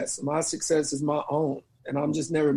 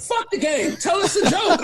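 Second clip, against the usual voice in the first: mock bossy friend; pushy demand; 12 semitones higher than usual. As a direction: brush off the game and insist. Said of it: "Rachel's like".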